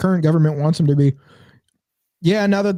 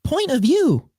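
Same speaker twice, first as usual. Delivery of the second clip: high, chirpy text-to-speech; TikTok voice; 11 semitones higher, slower than usual. Retold or imitated. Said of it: "I keep trying to do the chick".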